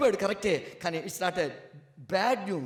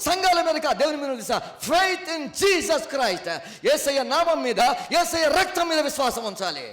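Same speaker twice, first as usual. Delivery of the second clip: high, loud declaiming sermon; booming pulpit proclamation, higher and louder; full force preaching; 5 semitones higher, 6 dB louder than usual. Acted. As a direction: proclaim it over the crowd.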